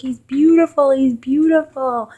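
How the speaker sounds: high, loud choked-up praise; tearful awe. Retold or imitated. Husband bawling over his newborn son, high and tearful. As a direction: say it through tears.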